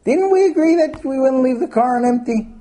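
high, loud accusing question; upset husband. Acted. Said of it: "he's very upset".

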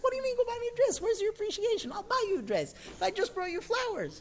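very high-pitched mock outrage; indignant husband, acted. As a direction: squawk back in a hammed-up marital row. Acted husband retorts in quarrel.

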